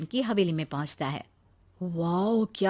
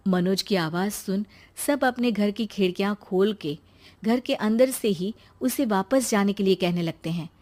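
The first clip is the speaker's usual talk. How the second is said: plain reading voice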